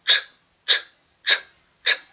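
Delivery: short, clipped ch bursts like a train; Ч said in sharp short bursts, a pause between each, like wheels on rails; train chugging sound. Shown as a demonstration. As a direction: chug out Ч, Ч, Ч like a moving train. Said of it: "Давайте промовимо".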